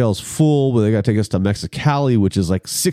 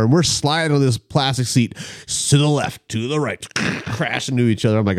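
ordinary talk, nothing marked

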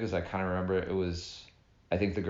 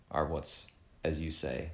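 ordinary talk, nothing marked

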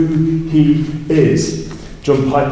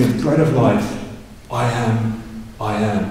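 "The bread of life" then a softer, lower "I am, I am"; emphasis on I am; 3 semitones lower, 4 dB quieter than usual. Shown as a demonstration. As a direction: let "I am, I am" sink and settle. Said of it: "the emphasis is not on him being the bread".

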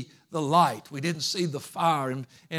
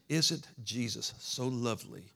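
soft, lower, careful proper phrasing; mock eloquent; 4 semitones lower, 7 dB quieter than usual. Preacher showing what you don't have to say, mock eloquent. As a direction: say it in mock-refined, properly worded style. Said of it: "I'm not very eloquent with my words".

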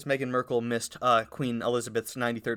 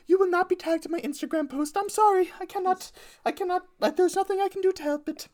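very high mock British queen accent; a hammed-up high British queen voice. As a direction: keep the squeaky British queen going. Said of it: "terrible British accent".

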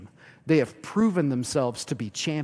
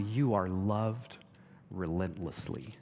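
tender; 7 semitones lower, 7 dB quieter, slower than usual. Real, not acted. Tender climax of sermon.

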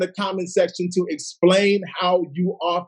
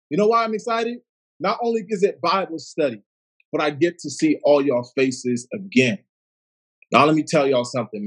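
ordinary talk, nothing marked

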